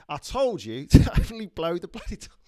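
hammed-up Cockney film-star impression; Michael Caine. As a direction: do a famous London actor's catchphrase, breaking into a laugh. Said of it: "you're talking like Michael Caine".

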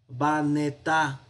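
Baneta drawn out slowly, every syllable clear; slow spelled-out form. Shown as a demonstration. Teacher slowing down so the class hears Baneta clearly. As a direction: say Baneta slowly so students catch each sound.

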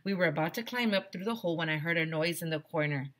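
ordinary talk

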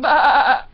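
loud, bleating baa; loud sheep imitation. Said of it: "I found a sheep who said".

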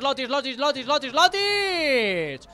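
drawn-out excited shout of the name; stretched excited name call. Real, not acted. (llamada estirada y emocionada)

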